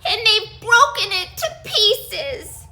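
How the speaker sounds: high, loud, upset little cub; crying baby bear. Acted. Baby Bear crying at his broken chair. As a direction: wail it like a tiny bear. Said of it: "he cried".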